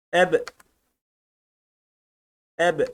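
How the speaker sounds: "Eb" stopped hard on the b, no vowel after it; cezimli okunuş. Shown as a demonstration. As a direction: strike the final b of "Eb" and stop. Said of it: "böyle vuruyoruz kalıyor arkadaşlar". (cezimle b'ye vurup kalarak)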